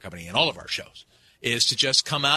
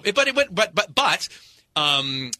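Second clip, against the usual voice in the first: ordinary delivery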